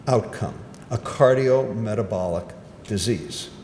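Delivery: normal talking voice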